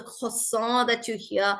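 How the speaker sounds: drawn-out French "croissant"; French-accented "croissant", slow. Shown as a demonstration. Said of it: "so in French accent".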